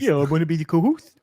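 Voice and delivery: put-on silly character voice. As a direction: slip into the goofy co-host character. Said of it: "do this character where you were, uh, kinda talking like this".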